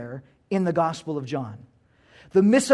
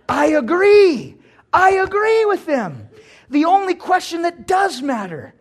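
emphatic rise; 9 semitones higher, 5 dB louder than usual. Real, not acted. Emphatic turn in sermon.

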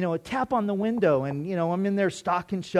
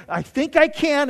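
loud, higher mock pep talk; little engine resolve; 9 semitones higher, 6 dB louder than usual. Man forcing himself to be nice to Mark, loud mock pep talk. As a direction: chant it like the little engine that could.

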